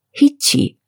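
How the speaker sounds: controlled, icy dismissal; frozen and calm. A narrator's reading. Elinor withdrawing her appeal to Mary, frozen and calm. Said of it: "یخزده و آرام گفت".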